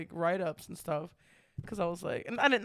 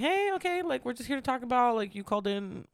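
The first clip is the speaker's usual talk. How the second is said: pitched up and shaky, settling toward normal; a jittery new supervisor starting the spiel; nervous high voice; 6 semitones higher than usual. Retold or imitated. New supervisor opening a write-up, nervously pitched up. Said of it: "I lose my voice".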